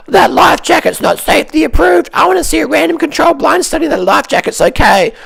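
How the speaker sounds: high, whiny mock voice of a naysayer; squeaky high parody of a sceptic demanding studies; mocking fuckwit voice. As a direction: sneer it out like a pedantic know-it-all. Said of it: "the fuckwit in the jetty saying".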